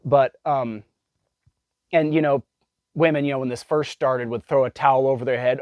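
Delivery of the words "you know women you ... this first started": ordinary talk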